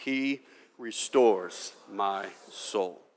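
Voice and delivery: measured congregational reading; slow scripture recitation. Shown as a demonstration. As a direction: read it slowly like a psalm. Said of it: "The last phrase from last week".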